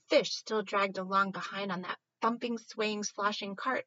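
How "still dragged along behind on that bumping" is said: ordinary delivery, nothing marked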